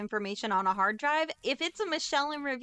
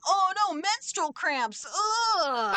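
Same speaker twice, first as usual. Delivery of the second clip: high, slow, pained whine with a groan; mock anguish; 9 semitones higher, 4 dB louder, much slower than usual. Identity thieves discovering her cramps, hammed-up high whine. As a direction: play the suffering thief hammed up. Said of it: "they're like".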